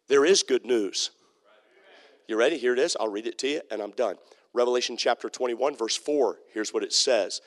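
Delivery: usual speaking voice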